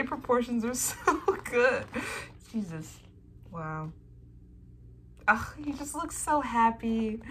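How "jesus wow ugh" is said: ordinary talk, nothing marked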